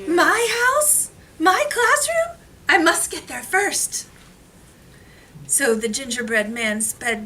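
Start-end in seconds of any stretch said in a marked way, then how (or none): 0.1-4.0 high, panicked cries; alarmed. Acted. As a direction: shriek it in alarm. Alarmed gingerbread man.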